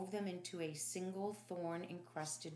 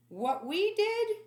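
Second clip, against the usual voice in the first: much higher, louder, slow mock disbelief; scoffing soldier; 11 dB louder, slower than usual. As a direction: throw the question back scornfully.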